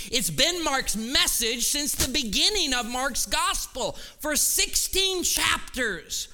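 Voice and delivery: fervent pulpit preaching; preaching at full force. Real, not acted. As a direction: drive it home to the congregation. Preaching the climax of sermon.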